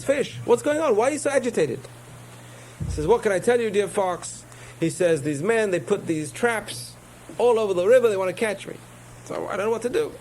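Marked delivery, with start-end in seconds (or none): 0.0-1.8 much higher, sly storybook fox; high-pitched fox asking the fish. Acted. 3.1-10.1 high, fretful fish voice; a high anxious little fish; worried fish. Acted.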